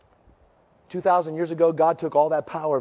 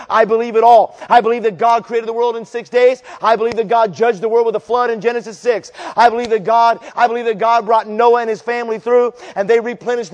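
loud, rising preacher's fervor, pounding each line; full-force preaching; 7 semitones higher, 9 dB louder than usual. Acted.

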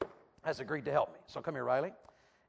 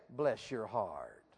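slow, low Southern drawl, softer; 8 semitones lower, 7 dB quieter, much slower than usual. Shown as a demonstration. Preacher showing a crowd how Southerners say it, slow Southern drawl. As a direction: stretch it out like a Southerner. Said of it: "say like a Southerner".

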